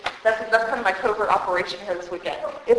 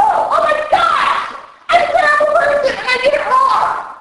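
panicked mock scream; 10 dB louder than usual. A retreat guest fleeing to the car after the exercise, in a panicked mock scream. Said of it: "and go".

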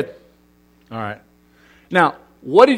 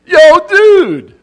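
much higher, loud surfer-dude greeting; Southern Cal dude; 13 semitones higher, 11 dB louder than usual. Shown as a demonstration. Pastor recalling Southern Cal greetings, hammed-up surfer dude. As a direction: hail your buddy on the beach. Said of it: "That was a favorite in Southern Cal".

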